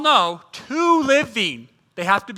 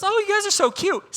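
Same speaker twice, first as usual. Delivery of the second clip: mock waitress gush; 10 semitones higher than usual. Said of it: "waiter or waitress comes up and goes".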